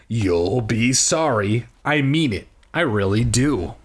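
ordinary delivery

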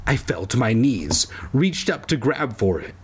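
ordinary delivery

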